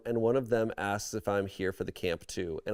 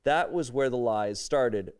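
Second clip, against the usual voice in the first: ordinary talk, nothing marked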